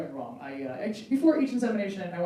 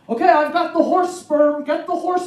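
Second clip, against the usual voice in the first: loud, higher, slow deadpan doctor gag; a doctor teasing his patient, loudly; joking doctor; 7 semitones higher, 7 dB louder, slower than usual. Retold or imitated. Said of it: "he walks in the room and goes".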